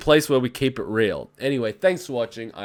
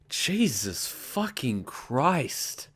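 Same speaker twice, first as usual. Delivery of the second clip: exasperated; 7 dB quieter, much slower than usual. Real, not acted. Exasperated outburst.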